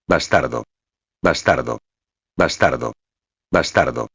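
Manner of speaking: low, repeated angry bastardo; shouting while kicking. A narrator's reading. Brute kicking the narrator, shouting low and angry. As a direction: spit out each bastardo with a kick. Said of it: "gritó mientras me pateaba".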